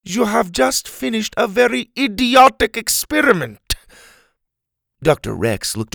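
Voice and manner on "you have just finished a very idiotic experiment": high, emphatic character voice; scornful scolding. Acted. Helga scolds Doctor Rex after the crash, scornful. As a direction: deliver a withering verdict, high and deliberate.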